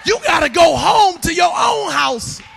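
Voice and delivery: loud, emphatic sermon delivery; full-force preaching. Acted. Preacher pleads to save the congregation, loud preaching. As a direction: drive each phrase home from the pulpit.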